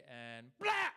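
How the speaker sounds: loud startling yell; sudden shout. Retold or imitated. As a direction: bark it to make them jump. Yelling to startle friends.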